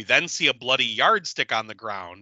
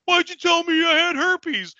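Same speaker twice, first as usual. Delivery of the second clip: much higher, whiny hammed-up complaint; whiny mock voice; 14 semitones higher, 5 dB louder than usual. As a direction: squeal it like an offended dude. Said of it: "He goes".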